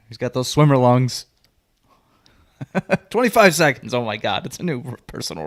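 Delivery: playful sports announcer counting; mock announcer. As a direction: call it like a live broadcast. Mock announcer counting breath hold.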